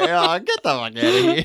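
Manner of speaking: high-pitched, mock-dismissive scoff; condescending scoff. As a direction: brush them off with incredulous condescension. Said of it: "the condescending nature of Maximus here".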